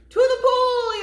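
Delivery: high, loud group shout; firemen yelling. Acted. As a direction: yell it out like a fire crew. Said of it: "yelled the firemen".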